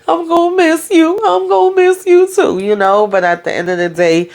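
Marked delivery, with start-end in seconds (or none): 0.1-2.6 high, tearful falsetto farewell; mock teary goodbye. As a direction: squeak it out like a weepy goodbye. Both looking tearful at parting.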